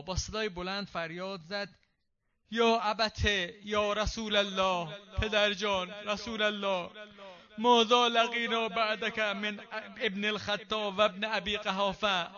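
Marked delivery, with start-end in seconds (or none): 2.5-12.3 a slow, loud cry to her father; loud lament. Acted. Preacher voicing Fatima crying out to her father, loud slow lament. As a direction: call out with all your voice, grieving. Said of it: "نادت به اعلی صوتها،".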